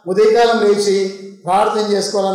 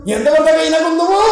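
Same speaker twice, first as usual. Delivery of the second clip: high, raised plea drawn upward at the end; crying out loudly; 6 semitones higher than usual. Retold or imitated. David protests to God that prayers go unheard, crying out loudly. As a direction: imitate David calling out to God. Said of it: "గట్టిగా దేవుని అరిచి మొదలు పెడతా ఉన్నాడు".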